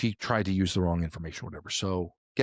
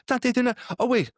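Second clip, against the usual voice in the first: flustered mom; 6 semitones higher than usual. Retold or imitated. Mom scrolling the thread.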